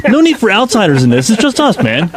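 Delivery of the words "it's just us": normal talking voice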